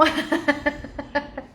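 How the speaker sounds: short amused laugh. Real, not acted. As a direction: laugh at a funny comment. Laughs at a mentee's comment. (risada divertida)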